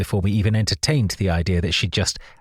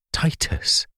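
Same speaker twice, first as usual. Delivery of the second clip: whispered name; 7 semitones higher than usual. A narrator's reading. Recognising a voice in dark.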